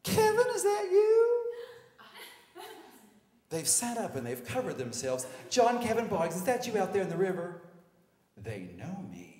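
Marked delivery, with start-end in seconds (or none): 0.0-1.5 very high, loud, slow calling voice; hammed-up high female impression; sorority girl. Sunbathing sorority girl calls out. 5.5-7.6 high, loud, quick calling voice; put-on girl recognizing someone; sorority girl. Sorority girl calls across river.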